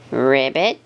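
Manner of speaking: deep ribbit croak; frog croak. Acted. Frog in the grass.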